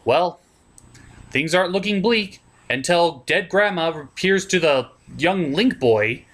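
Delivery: ordinary talk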